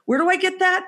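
high-pitched mimicked question; clueless husband. Husband asking wife where to find her podcast, clueless.

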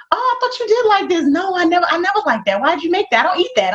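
high mock voice of a fussy husband; husband whining that he never liked that; mock picky husband. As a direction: lightly imitate him refusing the food.

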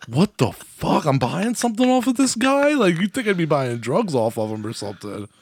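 retold baffled disbelief, pitch jumping up mid-way; a "what is this guy on" reaction; incredulous reaction. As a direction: act out being weirded out. Retelling his baffled reaction to classmate.

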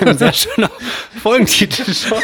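lachend und höher. Real, not acted. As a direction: durch Lachen hindurch sprechen. Lacht über den Folgentitel. (lacht über den Folgentitel)

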